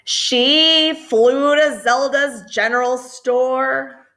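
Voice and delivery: lilting read-aloud voice; reading to children. A narrator's reading. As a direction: read it like a bedtime picture book. Story narration in rhyme.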